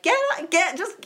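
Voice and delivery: high-pitched, urgent acted yell; frantic yelling. Daughter to friend as mother bangs on car, frantic yelling. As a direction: re-enact yelling in a panic, voice shooting up. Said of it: "yelling at me, and I'm like".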